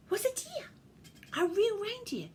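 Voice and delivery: high, astonished storytelling; wide-eyed, high-voiced amazement; amazed reveal. A narrator's reading. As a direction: reveal it in high-pitched wonder. Surprise reveal read to children.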